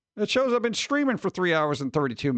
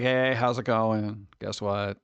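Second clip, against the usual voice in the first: lower, slow deadpan greeting; 6 semitones lower, slower than usual. Retold or imitated. A lightly deadpan greeting to the ISP tech. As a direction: drone it with no interest. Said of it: "really deadpan".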